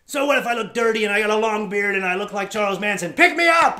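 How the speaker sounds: high, loud mock-indignant yell; indignant outburst. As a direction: demand a ride in a huff. Voicing frustrated hitchhiker's thoughts.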